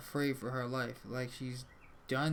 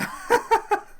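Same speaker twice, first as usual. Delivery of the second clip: loud high-pitched cackle; real laughter; 14 dB louder than usual. Real, not acted. Player reacts to being called an idiot, real loud laugh.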